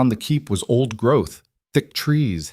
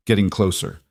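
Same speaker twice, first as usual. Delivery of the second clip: plain reading voice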